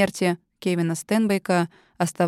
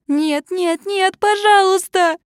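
much higher, loud, slow pleading; desperate pleading; 12 semitones higher, 5 dB louder, slower than usual. Acted. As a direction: beg desperately in tears. Narrator sobbing begs.

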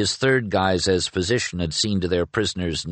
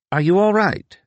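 higher and louder worried question; concerned; 12 semitones higher, 5 dB louder than usual. A narrator's reading. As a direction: ask urgently, worried for him. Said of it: "asked Penrick, concerned".

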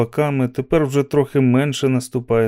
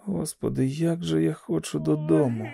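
soft, higher-pitched longing; very quiet; 5 semitones higher, 7 dB quieter than usual. A narrator's reading. Walker behind Garraty wishing to go home, very quiet. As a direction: plead softly, almost to yourself. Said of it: "дуже тихо хтось промовив".

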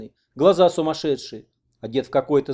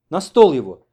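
loud, raised command; giving orders; 4 semitones higher, 10 dB louder than usual. A narrator's reading. Oksana directs the men with the wounded patient, commanding. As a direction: take charge firmly. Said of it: "это уже распоряжалась Оксана".

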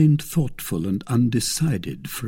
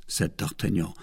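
plain reading voice

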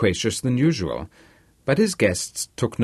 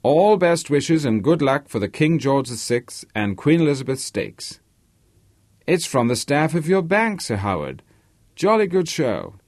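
higher-pitched Polish-American reading English phrases; Polish-American accent; 5 semitones higher than usual. Acted. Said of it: "Harvey's Polish-American accent made the English expression".

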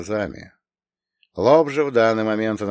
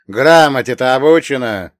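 loud, higher ironic question; mocking, raised-voice query; 4 semitones higher, 11 dB louder than usual. A narrator's reading. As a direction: needle her with a pointed question. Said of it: "иронично спросил я".

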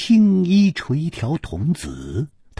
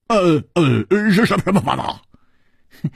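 higher, stuttering eagerness; eager stammer; 5 semitones higher, slower than usual. Acted. Hu Tiehua eagerly stammering at the boy. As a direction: stumble to ask quickly.

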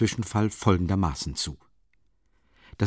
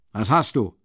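surprised; 3 dB louder than usual. A narrator's reading. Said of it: "rief Porfiri verwundert".

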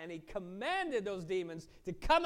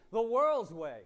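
loud preacher's punchline; booming declaration of the world's way; forceful declaration; 3 semitones higher, 9 dB louder, slower than usual. Acted. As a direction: hit the answer hard. Preaching climax answer.

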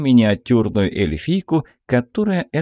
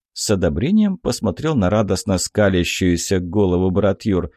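ordinary delivery

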